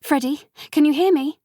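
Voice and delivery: high, loud eager question; excited calling at bedside; urgent call. A narrator's reading. Sophie rushes to the bed.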